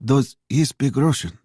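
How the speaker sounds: high-pitched waitress asking. A narrator's reading. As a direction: ask it high, as the Russian waitress. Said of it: "the waitress asked".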